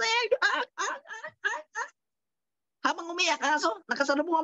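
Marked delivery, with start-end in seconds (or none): 0.0-1.9 high yelping dog cries; whimpering dog. Acted. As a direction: yelp like a kicked dog. Dog crying after kick. (mataas na iyak ng sinipang aso)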